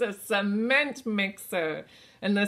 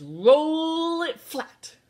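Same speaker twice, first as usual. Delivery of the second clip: slow; 7 semitones higher, 7 dB louder, much slower than usual. Shown as a demonstration. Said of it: "the action that the steamroller does".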